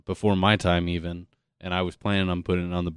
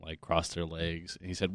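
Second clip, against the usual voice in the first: ordinary delivery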